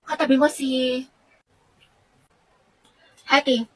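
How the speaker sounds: low, soft announcement; teacher announcing seats. Acted. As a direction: announce it softly with long pauses. Teacher announces new seating, perhaps imagined. (mahina at mababang anunsyo ng guro)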